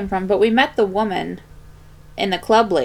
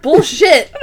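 scoffing; 6 semitones higher, 9 dB louder than usual. Retold or imitated. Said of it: "And I was like".

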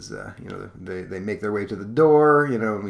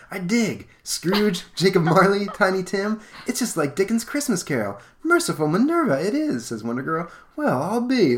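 much higher excited hero chatter; dawning excitement; 10 semitones higher, 3 dB louder than usual. Acted. The Titans realise it's a Christmas Carol, high excited voice. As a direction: catch on with rising delight.

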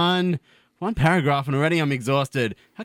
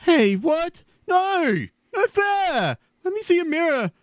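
high, shrieking panic; panicked high voice; 14 semitones higher, slower than usual. Acted. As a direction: wail in high-pitched dismay. Transformed, panicked outburst.

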